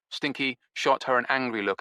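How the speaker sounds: ordinary delivery, nothing marked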